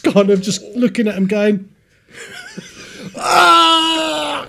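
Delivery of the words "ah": pained yelp. Retold or imitated. Retold pain from rope burns.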